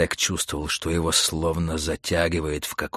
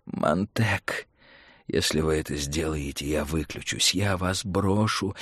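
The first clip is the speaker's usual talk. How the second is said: softer, mild threat; thin voice in the ear; 4 semitones lower, 5 dB quieter than usual. Acted. Mentor threatens to abandon Montag, warning softly. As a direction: warn him in a small voice. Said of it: "пронзительно звенело в ухе мошка".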